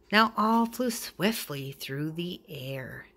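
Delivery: ordinary delivery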